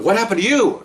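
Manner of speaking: high, startled boss asking what happened; a boss suddenly worried, pitching way up; alarmed question. Retold or imitated. Supervisor sees bleeding officer, asks in high alarm. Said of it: "So he goes".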